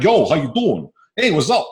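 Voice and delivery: loud, high Brooklyn guy greeting; hammed-up Brooklyn street accent. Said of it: "the guy from Brooklyn will come up".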